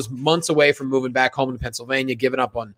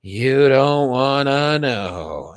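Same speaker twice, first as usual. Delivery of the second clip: mock slow coy taunt; 3 semitones lower, much slower than usual. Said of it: "She's like".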